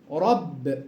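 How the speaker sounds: رب with a thick, heavy ra, drawn low. Shown as a demonstration. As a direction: say رب with the ra fully heavy. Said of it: "تفخم هكذا".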